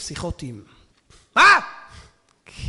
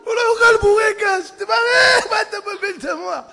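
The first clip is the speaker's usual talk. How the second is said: high loud mock outrage through laughter; preacher howling with laughter; laughing shout; 14 semitones higher, 12 dB louder than usual. Real, not acted. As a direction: crack up while shouting the punchline.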